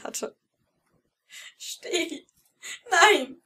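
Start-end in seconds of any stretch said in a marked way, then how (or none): 1.6-3.4 high, terrified cry of no; panicked scream. Acted. Girl with a knife at her throat crying out in panic. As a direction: scream it in panic, rising to a loud shriek. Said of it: "schrie sie panisch".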